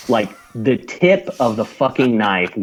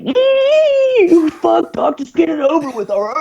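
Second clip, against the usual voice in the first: mocking a squealing marine in a much higher voice; 4 dB louder than usual. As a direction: squeal it out, much higher and a bit louder, like a wimp in pain. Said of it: "he's squealing".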